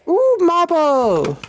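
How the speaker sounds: high, slow thrilled "Ooh, marble!"; delighted ooh. Real, not acted. Streamer finding marble in his empire, delighted high squeal. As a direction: savour the find in a high squeal.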